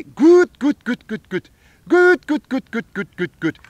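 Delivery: loud high quacking "Good" bursts, fading down; duck call honks. Shown as a demonstration. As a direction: quack "Good" like a lonesome hen. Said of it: "to call a lonesome hen".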